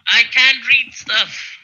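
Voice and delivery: loud, high, slow complaint; indignant protest. Real, not acted. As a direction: protest loudly, dragging the words. Snaps back at being asked.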